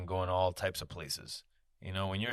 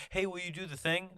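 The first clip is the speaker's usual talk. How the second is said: higher, sweetly asking a favour; polite request; 10 semitones higher than usual. Retold or imitated. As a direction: ask nicely, pitch up. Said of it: "It's not like".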